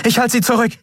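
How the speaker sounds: high, pressing command; captain urging Kai to run; urgent order. A narrator's reading. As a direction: push him out the door.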